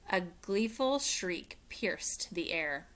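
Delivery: ordinary delivery, nothing marked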